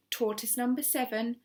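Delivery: ordinary delivery, nothing marked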